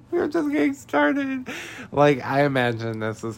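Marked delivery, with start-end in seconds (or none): 0.1-1.5 very high mock wail; hammed-up bawling. Recapper voices Hannah mourning Manny, hammed-up bawling. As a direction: squeal it out as a fake sob. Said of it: "She starts bawling her eyes out".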